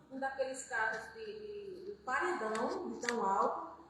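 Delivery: normal talking voice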